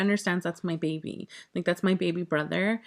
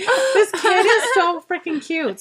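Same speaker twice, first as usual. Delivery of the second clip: laughing gush; 11 semitones higher, 8 dB louder, slower than usual. Real, not acted. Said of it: "And I was just like".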